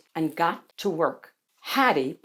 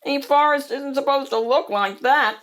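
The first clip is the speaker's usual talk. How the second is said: higher, whiny protest; squeal; 6 semitones higher, 4 dB louder than usual. A narrator's reading. Seymour objecting to Hattie's painting, squealed protest. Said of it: "Seymour squealed".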